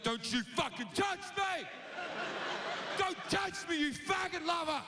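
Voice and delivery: high-pitched raging yell; angry shout. Acted. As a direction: scream to keep them away. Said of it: "He's like".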